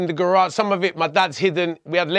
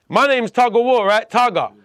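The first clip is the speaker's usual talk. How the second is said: usual speaking voice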